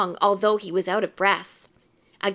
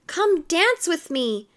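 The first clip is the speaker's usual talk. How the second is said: drawn-out, higher-pitched invitation; lightly voiced eager plea; eager cry; 6 semitones higher, slower than usual. A narrator's reading. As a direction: coax him onto the floor. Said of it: "each of them cried".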